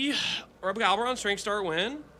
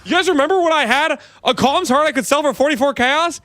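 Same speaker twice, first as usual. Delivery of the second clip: high, worked-up complaint; indignant rant; 8 semitones higher than usual. Real, not acted. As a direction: rant at the chat in disbelief. Ranting about lost profit.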